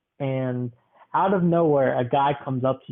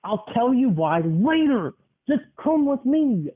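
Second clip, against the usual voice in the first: higher, slow, insistent plea; pleading stranger; 9 semitones higher than usual. Acted. Grandchild voicing a stranger urging grandma to follow, high and slow. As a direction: coax her along, pitch climbing.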